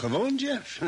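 high, scoffing repeat of the word; incredulous echo. Acted. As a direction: throw the word back, disbelieving. Doctor scoffs at word normal.

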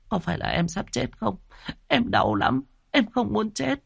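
high, tearful pleading; a frightened young wife near tears; whimpering. A narrator's reading. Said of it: "mếu máo". (mếu máo sợ chết)

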